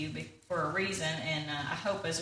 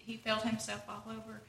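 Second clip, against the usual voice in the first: ordinary delivery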